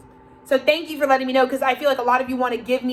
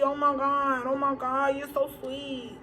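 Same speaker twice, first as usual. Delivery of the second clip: breathy, soft, slow fake gratitude; mock gushing thanks; 6 dB quieter, slower than usual. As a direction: gush like a fawning loser, hushed and drawn out. Mocking gushing handout-takers.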